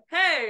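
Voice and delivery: bright, loud warm-up shout of hey. Shown as a demonstration. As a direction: call out "Hey" like a vocal exercise. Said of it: "or people go like".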